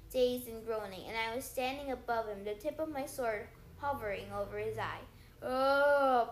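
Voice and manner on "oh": loud pained groan of "Oh"; a beaten giant moaning. Acted. Defeated Cyclops moaning up at Percy, loud moan. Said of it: "Polyphemus moaned".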